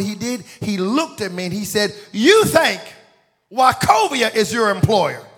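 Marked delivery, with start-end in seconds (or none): 2.1-5.2 high, loud preacher's demand; re-enacted booming rebuke; incredulous challenge. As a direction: challenge him at full voice, stretching it out.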